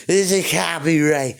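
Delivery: slow, pompous claimant voice; a hint of a smug copyright troll; mocking bozo. Vlogger mimicking a copyright claimer, slow and smug. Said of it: "some bozo there trying to claim".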